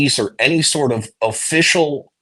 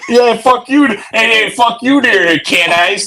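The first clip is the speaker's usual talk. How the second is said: loud, high Midwestern twang; hammed-up Midwest accent, shouted; 10 semitones higher, 4 dB louder than usual. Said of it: "That's my Midwest accent".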